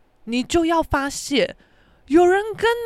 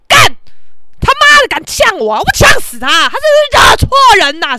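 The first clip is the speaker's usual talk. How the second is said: higher, louder furious rant; 8 semitones higher, 8 dB louder than usual. Retold or imitated. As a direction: yell it so the whole street hears. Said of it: "很大的音量说". (大声飙骂)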